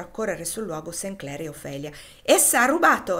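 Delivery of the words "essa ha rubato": loud, high cry of accusation; outraged, high-pitched shout; exclaimed accusation. A narrator's reading. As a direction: denounce the culprit at full voice. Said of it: "esclamò Rosa".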